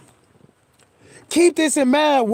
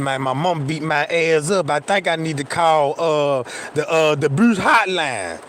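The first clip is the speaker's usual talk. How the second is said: dejected mumble; 9 semitones lower than usual. Retold or imitated. Kid telling best friend about whooping, low and dejected. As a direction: mumble it like you've been through it.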